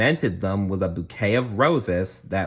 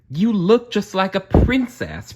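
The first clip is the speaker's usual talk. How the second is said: much higher, louder, proud and insistent; bossy Prudence; 11 semitones higher, 5 dB louder than usual. A narrator's reading. Prudence insisting Cinderella looks like a princess, high and bossy. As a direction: gush over the dress to overrule her. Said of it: "Prudence said".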